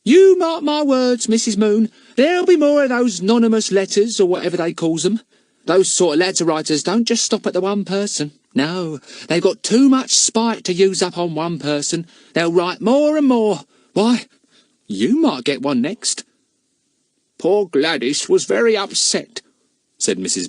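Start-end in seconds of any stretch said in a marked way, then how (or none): 0.0-16.2 much higher, louder country gossip; a cockney charwoman gossiping, higher and louder; cleaning woman's voice. Acted. Mrs Cockles gossiping. 17.4-19.4 higher, gossiping cook; a village woman talking over the fence; lightly voiced gossiping housekeeper; housekeeper's voice. Mrs Moon speaking.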